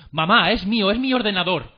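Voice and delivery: high, loud mock-panicked cry; imitating a frightened boy. Friend retelling a boy startled by his mother, mock-frightened shout. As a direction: plead loudly, like a startled kid caught out. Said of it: "él todo asustado le dijo".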